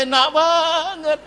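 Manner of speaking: high, drawn-out mock amazement; mocking exclamation. Real, not acted. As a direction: stretch it out scornfully, high in pitch. Mocks an easy demand. (sindiran bernada tinggi dipanjangkan)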